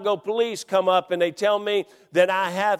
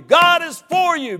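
louder and higher still; ringing repeat; repeated shout; 10 semitones higher, 7 dB louder than usual. Acted. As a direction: keep building. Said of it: "Look at me like you believe it".

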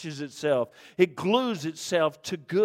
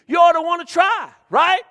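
loud, high, rising call to the congregation; preaching shout; 12 semitones higher, 9 dB louder than usual. Real, not acted. Preacher pressing his flock, full-force shout. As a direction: throw it out to the room at full force.

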